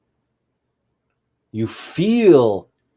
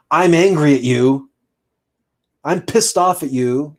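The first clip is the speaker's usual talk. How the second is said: higher, louder, slower heated blame; mock angry; 5 semitones higher, 3 dB louder than usual. Shown as a demonstration. Teacher acting a partner's angry outburst, mock heated. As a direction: let the irritation show as you accuse. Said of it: "How different is that than saying".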